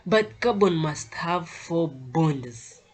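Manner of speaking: "carbon must have four bonds" slow, stressing "carbon"; slow emphatic stress. Shown as a demonstration. Teacher repeating the rule to students, slow and emphatic. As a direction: spell out "four bonds" slowly and deliberately. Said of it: "why I'm emphasizing this".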